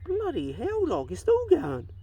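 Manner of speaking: high, rising exclamation of amazement; real incredulity. Real, not acted. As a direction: exclaim in high-pitched disbelief. Amazed dog is still peeing.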